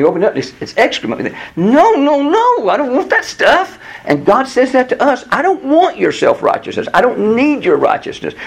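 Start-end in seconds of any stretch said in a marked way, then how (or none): 1.6-3.8 high-pitched, insistent refusal; emphatic refusal. Acted. Acted refusal of an unwanted gift. 5.3-8.3 high, insistent rejection; emphatic rejection. Acted. Voices God refusing self-righteousness.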